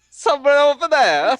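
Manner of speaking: very high, loud cartoon Italian; mock Italian accent. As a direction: sing it out in hammed-up Italian. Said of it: "You gotta do your Italian accent".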